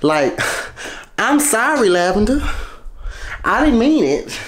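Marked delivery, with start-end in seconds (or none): 1.2-4.4 high-pitched, pleading mock apology. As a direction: playfully apologize to the lavender.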